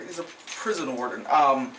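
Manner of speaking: usual speaking voice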